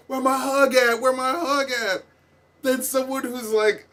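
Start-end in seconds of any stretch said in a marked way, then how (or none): 0.1-1.0 mock begging. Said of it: "sounding like he's begging them for a hug". 1.0-2.0 mock begging. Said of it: "sounding like he's begging them for a hug".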